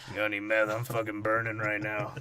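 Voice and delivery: drug seeker. Acted. Voicing a drug-seeking robot.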